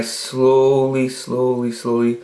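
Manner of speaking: drawn-out, hushing word by word; stealthy slow. Retold or imitated. Man creeping to the stereo unheard, slow and careful. Acting out stealthy volume fade.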